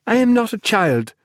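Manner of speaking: high indignant protest; angrily. A narrator's reading. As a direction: snap back, offended. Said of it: "she said angrily".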